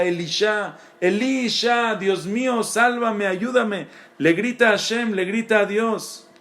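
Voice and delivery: high, pleading cry for rescue; anguished cry to God. Acted. The soul begging God for help, anguished cry. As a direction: call out desperately, voice climbing.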